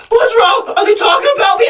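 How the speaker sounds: high-pitched mock paranoid yelling; imitated paranoid outburst. Tripping friend overhears the others talking, high paranoid mock yell. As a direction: blurt it high and loud, as the panicky friend.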